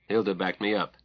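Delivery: usual speaking voice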